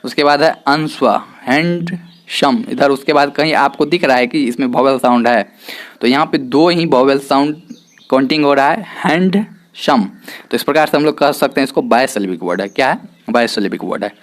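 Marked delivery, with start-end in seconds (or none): 1.4-2.6 हैंडसम slowly broken into "hand" and "sum"; syllable-by-syllable pronunciation. Shown as a demonstration. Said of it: "देखेंगे उच्चारण कैसे होता है". 9.1-10.0 हैंडसम slowly split, "hand" raised, "sum" dropping; syllable-by-syllable pronunciation. Shown as a demonstration. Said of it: "देखेंगे उच्चारण कैसे होता है".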